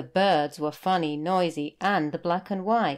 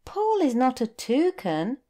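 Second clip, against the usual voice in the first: put-on higher child voice, a little let down; disappointed boy; 7 semitones higher than usual. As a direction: correct the whale with a sigh of hope fading. Boy corrects, losing hope.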